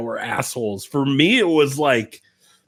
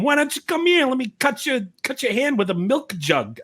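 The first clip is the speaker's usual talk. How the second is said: mock Bronx accent, much higher and thuggish; 10 semitones higher than usual. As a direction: ham up a Bronx tough-guy accent, pitched way up. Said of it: "they sound like they're straight out of the Bronx".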